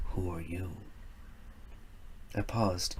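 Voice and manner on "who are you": lightly voiced old wanderer, a touch lower and softer; a hint of a deep oaky stranger. Stranger turns the lad's question back on him, lightly lower. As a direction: turn the question back on him, gently amused. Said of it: "A rich oaky tone, deep as darkness".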